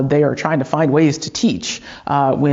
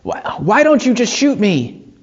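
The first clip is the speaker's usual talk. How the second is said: higher, slower retold plea; mildly worked-up challenge, pitched up and drawn out; agitated; 8 semitones higher than usual. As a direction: imitate an agitated man daring someone. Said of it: "and he's agitated".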